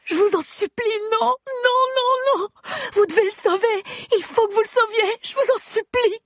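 very high, desperate begging; hysterical pleading. Acted. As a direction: beg them in anguish to save him. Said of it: "je hurle".

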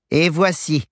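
wicked stepmother. A narrator's reading. Madame André voiced in story.

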